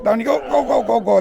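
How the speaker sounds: high, eager coaching calls; urging. Real, not acted. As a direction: push him on to finish. Coaching takedown, urging student.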